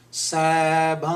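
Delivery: "Sabhang" drawn out on a humming nasal, no hard G; "Sabhang" slow, fading into a nasal ring; nasal N sound ending. Shown as a demonstration. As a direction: hold the end of "Sabhang" as a long "ng" hum. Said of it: "This is the correct pronunciation".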